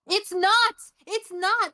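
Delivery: emphatic denial. Real, not acted. Insisting over friend's teasing.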